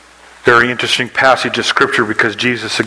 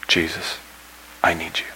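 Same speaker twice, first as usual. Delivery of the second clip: low, soft plea with a pause after the name; a quiet, understated prayer; hushed prayer; 4 semitones lower, 8 dB quieter than usual. Acted. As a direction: pray it softly and low. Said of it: "the prayer of a child".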